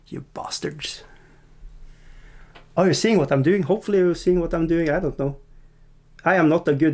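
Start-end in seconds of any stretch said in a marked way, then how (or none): 0.1-1.0 breathy whisper, playfully accusing; whispered tease. Real, not acted. Streamer teasing viewers who love his bad rolls, whispered. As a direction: hiss it fondly at the audience.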